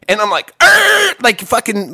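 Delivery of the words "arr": loud, high cartoon shock yelp; Wile E. Coyote yelp. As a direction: yelp like a startled Looney Tunes character. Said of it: "like the fucking Wile E. Coyote".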